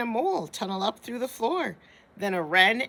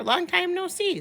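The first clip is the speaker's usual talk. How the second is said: higher, bright hare voice; hare's greeting; 11 semitones higher, 3 dB louder than usual. Acted. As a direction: greet the mouse cheerfully. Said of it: "says Hare".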